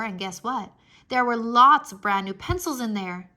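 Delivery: usual speaking voice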